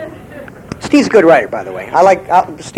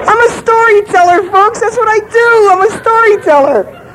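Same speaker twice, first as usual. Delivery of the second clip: much higher, mock-proud announcement; mock grand. Real, not acted. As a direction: proclaim yourself with mock pomp.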